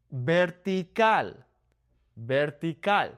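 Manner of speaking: "Vertical" starting with a soft B, ber-tee-KAL; Spanish "Vertical" with B sound. Shown as a demonstration. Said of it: "B and V in Spanish make the same sound".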